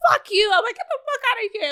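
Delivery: high, loud laughing yell; yelling at him. Retold or imitated. Cussing out her ex in the street, laughing yell.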